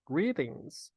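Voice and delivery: "Greetings" with the T as a quick R sound; tapped T. Shown as a demonstration. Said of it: "o T aqui fica com som de R". ("Greetings" com o T soando como R)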